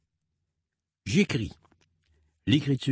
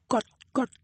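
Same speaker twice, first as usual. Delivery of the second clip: much higher clucking hen; 14 semitones higher than usual. Pretend. Parent imitating a hen for a child, high cluck. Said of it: "fais la poule".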